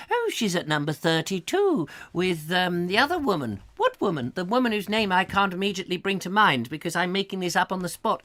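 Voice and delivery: a hint of a snooty old lady; lightly voiced prim gossip; snobbish neighbour. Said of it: "I've got to get out of character".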